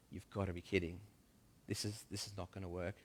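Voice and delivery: low, quiet grumble of disbelief; muttered disbelief. Acted. Thomas muttering to himself as Jesus picks Matthew, low and soft.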